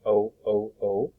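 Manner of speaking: drawn-out long O, like in rope; teacher modelling a long O vowel; long O sound. Shown as a demonstration. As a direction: stretch the vowel into a clear long O. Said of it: "long O sound".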